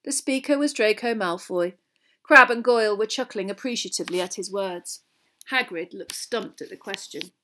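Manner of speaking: ordinary delivery, nothing marked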